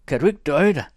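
high, very loud, indignant flare; flaring up angrily. Acted. Jørgine pulling free of Thomas, flaring up angrily. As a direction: snap loudly, high with sudden temper. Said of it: "sagde hun opfarende".

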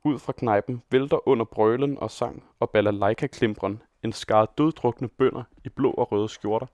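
plain reading voice

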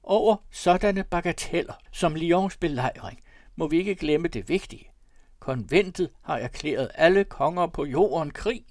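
insistent, high and loud; shouting. A narrator's reading. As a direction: declaim over a noisy room. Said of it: "råbte han flere gange".

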